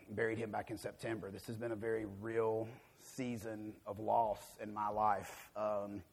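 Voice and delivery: soft, low, heavy-hearted delivery; subdued grief. Real, not acted. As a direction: share a real loss quietly. Speaking of recent bereavements.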